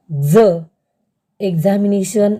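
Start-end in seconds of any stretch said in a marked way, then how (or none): 0.1-0.5 झ said alone, voiced z, punched loud; sound demo. Shown as a demonstration. Teacher stressing the झ sound for students, loud. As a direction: say झ firmly as the model sound. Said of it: "exercise".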